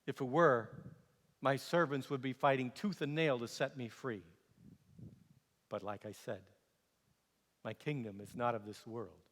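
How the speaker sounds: low, soft, measured assurance; calm, quiet reply. Acted. Prisoner explaining his kingdom to the governor, quietly calm. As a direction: let it sink softly, unforced.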